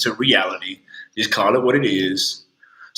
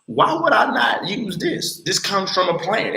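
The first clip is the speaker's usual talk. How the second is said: slow, much higher, incredulous; excited high question; 10 semitones higher than usual. Real, not acted. Telling viewers about agave, slow amazed high question. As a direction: stretch it out, amazed it's so obvious.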